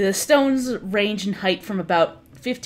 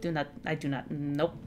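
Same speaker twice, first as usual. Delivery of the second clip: lower, softer conspiratorial aside; hushed aside; 5 semitones lower, 9 dB quieter than usual. Acted. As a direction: drop the voice for a secret aside. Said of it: "stops and looks at you and goes".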